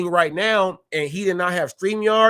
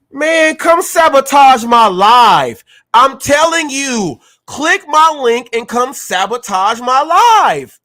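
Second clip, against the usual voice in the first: higher, louder and slow, daring them; taunting challenge; 7 semitones higher, 7 dB louder than usual. Real, not acted. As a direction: shout the dare at the haters, drawn out. Taunting critics to come sabotage him.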